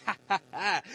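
imitated loud "ha ha ha"; mock laugh. Imitating haters who laugh too fast, mock laugh. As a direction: fake a loud, mocking laugh. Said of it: "people that laugh too, too fast".

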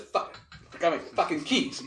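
high, exasperated realization; suddenly remember in dismay. Retold or imitated.

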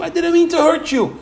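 high, injured denial; defensive protest. Retold or imitated. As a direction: pretend to be the hurt offender. Acted quarrel, offender's defence.

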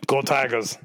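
a hint of a sideline coach cheering his team; Coach O impression. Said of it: "everybody has to do a Coach O impression".

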